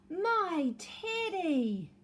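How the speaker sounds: slow, loud, high joyful cry; Eddie cries out. Acted. Eddie finding his teddy, loud and drawn out. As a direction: cry out with relief at finding him. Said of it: "cried Eddie".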